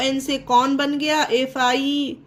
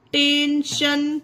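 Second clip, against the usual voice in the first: "Tension" said slowly, "शन" sounding clearly as sha; slow model word; much slower than usual. Shown as a demonstration. Teacher modelling a word slowly for students. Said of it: "उच्चारण क्या बना".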